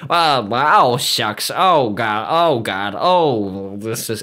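a lightly voiced folksy old president, mock-humble; Reagan impression. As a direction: play it aw-shucks and bashful, trailing off. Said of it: "Reagan said".

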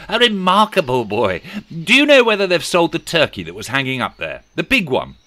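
high, loud enthusiastic calling; eager delight. Acted. Scrooge asking the boy about the turkey, excited. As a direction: gush praise, then ask keenly.

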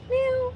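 high-pitched cat meow; imitated meow. Owner teases the pets, high imitated meow. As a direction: mew like a cat on one high note.

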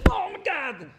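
high startled cry of realisation; a man in bed hit by an epiphany, high startled cry; sudden epiphany. Retold or imitated. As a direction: gasp it out as it dawns on you. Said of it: "sit in your bed one day and go".

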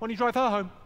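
put-on high, loud jealous girlfriend rant; mock jealous outburst from the passenger seat. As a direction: throw the accusation at him, shrill and loud.

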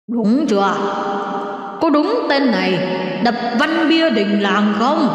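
otherworldly questioner, drawing out the last words; interrogating demon officer. A narrator's reading. Demon officer questioning about the broken stele, stern interrogation. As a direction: question the culprit like an underworld enforcer. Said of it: "mắt nhìn ông Kiệm hỏi".

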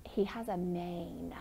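soft, slow secret hint for the class; hushed clue. Shown as a demonstration. Teacher giving a hushed clue.

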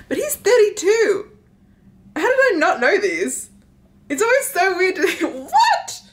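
high-pitched, incredulous outburst; shocked disbelief. Real, not acted. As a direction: exclaim it like you cannot believe it. Shocked reaction to character's age.

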